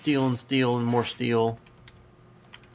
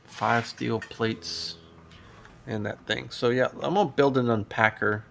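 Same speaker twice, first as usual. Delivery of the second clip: normal talking voice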